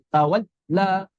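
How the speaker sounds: 'tawalla' with the l cut off, gap before 'la'; syiddah lam. Shown as a demonstration. Teacher demonstrates lam read as syiddah, blocked sound. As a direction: stop the sound dead on the l of 'tawalla'. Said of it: "Lam dibaca syiddah begini".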